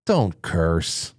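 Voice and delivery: prim scolding. Pretend. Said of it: "When you have me saying".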